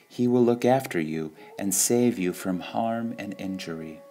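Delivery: ordinary talk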